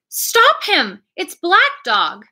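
high, loud alarmed cry. A narrator's reading. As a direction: call out to stop him. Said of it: "I cried".

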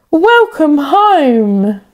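slow, drawn-out happy greeting; joyful welcome. Acted. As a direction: welcome him warmly and broadly. Villagers greet the captain.

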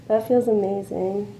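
delighted laugh, then words; laughing with pleasure. Real, not acted. Client laughing during massage.

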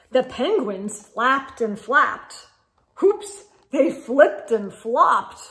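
put-on lively read-aloud for kids; animated storybook reading; story narration. A narrator's reading. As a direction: read it like a picture book.

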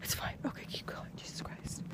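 scared hushed whisper; breathless nighttime whisper. Real, not acted. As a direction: whisper so nobody outside hears. Sneaking outside, whispering in fear.

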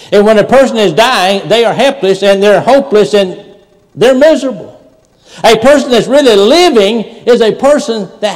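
loud, high, ringing preacher's voice; a revival preacher lifting the room with every line; full-force preaching. Acted. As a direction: preach it out hard to the pews. Preaching to congregation.

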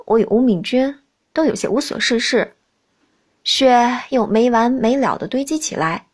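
usual speaking voice

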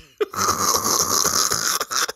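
long wheezing laugh; real laughter. Real, not acted. Host cracking up over the man balling out, wheezing laughter. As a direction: laugh till only breath comes out.

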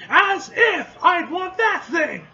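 high, loud, slow scoff; scornful shout. Acted. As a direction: bark it with contempt. Character scoffs loudly in dialogue.

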